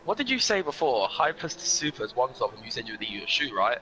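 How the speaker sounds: usual speaking voice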